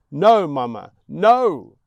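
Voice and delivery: very loud, high no; yelling the refusal; desperate shout. Acted. Boy refusing his mother's plea, screaming.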